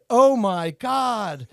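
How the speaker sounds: drawn out in shock; shocked stranger. Retold or imitated. A stranger walks in, slow shocked oh my God. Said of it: "I didn't know the voice that said".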